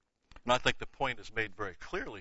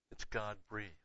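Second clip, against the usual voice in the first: soft echo; 9 dB quieter than usual. Shown as a demonstration. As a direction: let the phrase fall back to a hushed echo.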